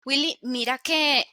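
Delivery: ordinary talk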